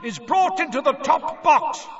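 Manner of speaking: high, loud announcer roar; Bagman's booming roar. A narrator's reading. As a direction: bellow it out to the whole stadium. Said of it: "roared Bagman".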